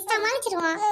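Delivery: usual speaking voice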